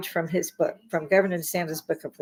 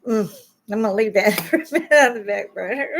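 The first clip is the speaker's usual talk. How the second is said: chuckling through the words; laughing it off; 4 dB louder, faster than usual. Real, not acted. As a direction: laugh away the anger. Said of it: "trying not to cuss".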